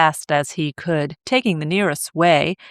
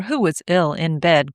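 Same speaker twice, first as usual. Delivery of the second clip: plain reading voice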